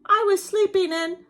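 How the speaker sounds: high, loud, put-on whiny bear; whimpering bear wail. Bear complaining his guests had all the fun, acted whimpering wail. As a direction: wail it like a hard-done-by bear. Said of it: "he whimpers and he moans and he wails".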